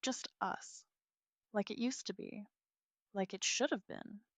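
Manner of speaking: whispered, softly sad; soft, sad smile. Acted. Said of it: "a small, sad smile".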